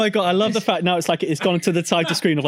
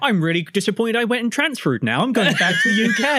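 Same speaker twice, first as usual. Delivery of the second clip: a lightly put-on West Country voice, slightly higher; West Country accent; 5 semitones higher than usual. West Country man complains about his bitten burger. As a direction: West Country moan about moving abroad. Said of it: "it's West Country".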